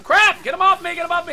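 high, loud panic; panicked yell. Real, not acted. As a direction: yell for help in a fight. Being attacked in game.

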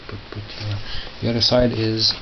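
ordinary talk, nothing marked

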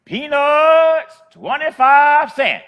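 high, loud drawn-out peddler's cry; street vendor call. Retold or imitated. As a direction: call it out like an old lady selling peanuts down the street. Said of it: "she yells out".

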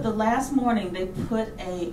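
ordinary delivery

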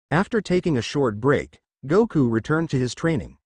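ordinary delivery, nothing marked

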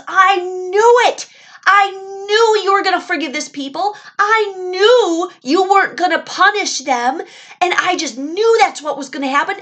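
high, loud, exploding complaint at God; Jonah furious. Acted. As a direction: rant like a furious prophet throwing a fit. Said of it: "That's how angry he was".

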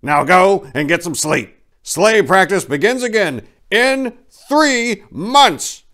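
cheerful boss dismissing the crew; jolly Santa. A narrator's reading. Santa sending reindeer to bed, lightly voiced jolly and loud. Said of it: "the jolly old elf chortled".

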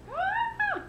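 short high-pitched "ah"; exasperated squeal. Real, not acted. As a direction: let out a small high squeal of frustration. Fussing with ridiculous hair.

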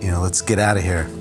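ordinary talk

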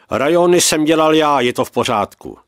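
loud, high protest easing off; outburst. A narrator's reading. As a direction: burst out in objection, then settle. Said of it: "Josef to nevydržel a ozval se". (hlasitý protest, výbuch netrpělivosti)

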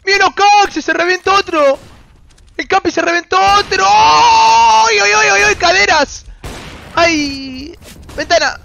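very high, loud play-by-play screams; excited cheering. Real, not acted. Streamer cheering the team's kills, excited shouting. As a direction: yell each kill as it lands, voice soaring.

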